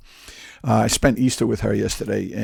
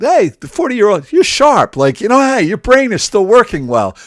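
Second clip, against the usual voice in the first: exaggerated cheery praise, much higher and louder; mocking how people praise the elderly, much higher and louder; 9 semitones higher, 7 dB louder than usual. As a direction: overdo the compliment like talking to a child. You don't describe a forty-year-old.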